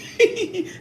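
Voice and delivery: quick high burst of laughter. Real, not acted. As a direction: laugh along with the chat. Viewers laughing at her.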